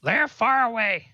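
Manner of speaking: high-pitched goblin voice, halting; goblin prisoner. Acted. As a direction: answer as a cornered little goblin in broken phrases.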